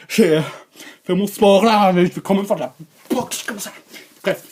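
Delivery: ordinary delivery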